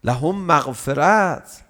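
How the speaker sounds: slow chanted verse, fading at the end; Quran recitation. Acted. Preacher reciting the Quran verse to the congregation, chanted.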